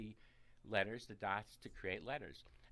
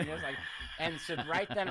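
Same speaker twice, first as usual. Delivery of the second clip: loud and high, bubbling over; excited; 7 semitones higher, 11 dB louder than usual. Real, not acted. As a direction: burst out with thrilled energy. Excited retelling child's scream.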